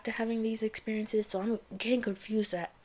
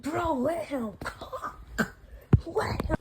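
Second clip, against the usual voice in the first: slow, drawn-out, much higher hype; slow amazed cheer, much higher; hyped reaction; 7 semitones higher, 3 dB louder, much slower than usual. Retold or imitated. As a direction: stretch it out like a stunned fan cheering. Her reaction when the lights come back.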